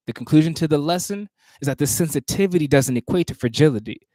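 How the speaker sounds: measured, rhythmic poetry recitation; spoken word performance cadence; spoken word poem. Acted. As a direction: perform the poem with weight on each phrase.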